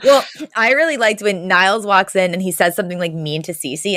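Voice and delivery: ordinary delivery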